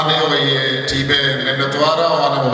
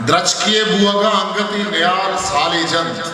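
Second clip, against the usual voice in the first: measured, higher-pitched poetry recital with sustained lines; ghazal recitation; 6 semitones higher than usual. Acted. Poet presenting a ghazal to a gathering, measured higher recitation. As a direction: recite verse in a lilting mushaira cadence, falling on the refrain.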